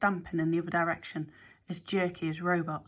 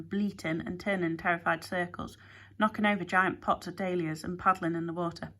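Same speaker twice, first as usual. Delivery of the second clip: ordinary delivery, nothing marked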